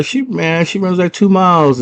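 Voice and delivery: normal talking voice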